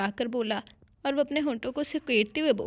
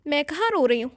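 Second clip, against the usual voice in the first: louder, defensive near-shout; half shouted denial; 4 semitones higher, 6 dB louder than usual. A narrator's reading. Wife caught crying snaps at her husband, half shouting. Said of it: "वो इस बार कतरे चिल्लाकर बोली".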